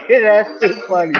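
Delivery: high laugh into slowed words; real amused laughter. Real, not acted. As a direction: crack up, then speak through it. Amused by viewer's KFC comment.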